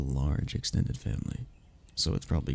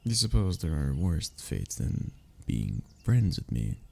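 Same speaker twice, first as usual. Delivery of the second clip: ordinary delivery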